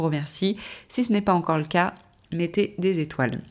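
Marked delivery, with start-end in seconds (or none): none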